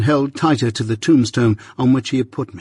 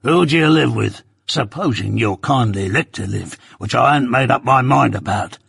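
gruff convict. Acted. Convict threatens boy.

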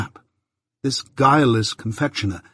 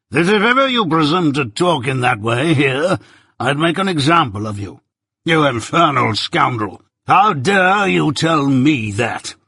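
irate; 4 semitones higher, 4 dB louder than usual. A narrator's reading. Jaggers furiously scolding the client Mike. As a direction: tear into the scoundrel. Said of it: "became most irate".

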